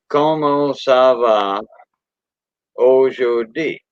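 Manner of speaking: "Como se va aujourd'hui?" said slowly, each syllable separate; slow syllable count. Shown as a demonstration. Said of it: "that's seven syllables".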